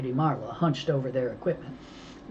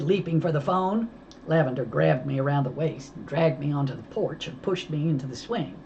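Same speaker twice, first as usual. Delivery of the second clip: ordinary delivery, nothing marked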